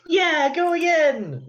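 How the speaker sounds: high, loud mock cheer, drawn out. Player voices Celeste cheering Lucas. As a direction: cheer a fighter on from the sidelines. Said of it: "she cheers".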